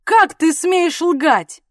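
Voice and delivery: loud, high, drawn-out outrage; angry accusation. A narrator's reading. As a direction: berate her, slowly and loudly. Husband berates wife for lying.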